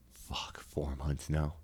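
breathy whisper under the breath. Real, not acted. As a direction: drop to a whisper. Unvoiced aside.

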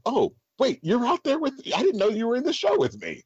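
sudden high-pitched "oh wait" discovery; surprised realization. Retold or imitated. Performer coming offstage, shocked at castmate, surprised high. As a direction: blurt it out, amazed you never noticed them.

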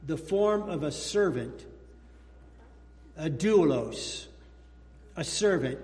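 slow solemn scripture reading. Acted. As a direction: read Paul's words with weight. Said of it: "Paul says".

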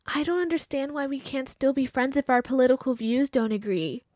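soft, put-on whiny voice; mocking the post. Mocking her former friend's post in a soft whiny voice. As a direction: read the post like a whiny victim. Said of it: "It says this".

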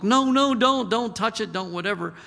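urgent protest. Retold or imitated. Grandson in pain telling grandpa not to touch, urgent. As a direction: blurt it out like a hurting child pushing a hand away. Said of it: "And I'm trying to look".

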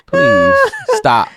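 very high, loud begging; mock exasperated plea. Begging validation seekers to quit, mock-exasperated. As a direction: plead it way up high, hammed-up.

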